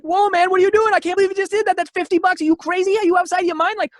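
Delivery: high-pitched, incredulous outburst; freaking out. Acted. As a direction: freak out at a baffling act, voice way up. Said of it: "Dave freaks out".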